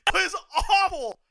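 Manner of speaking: real fan outrage; excited yelling. Real, not acted. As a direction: yell at the screen in disbelief. Reacting to missed chair shot.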